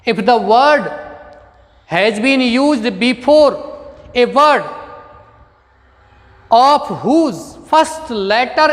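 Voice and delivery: very slow, measured rule dictation. Shown as a demonstration. Teacher dictates grammar rule to students, very slowly. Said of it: "dictating a rule slowly".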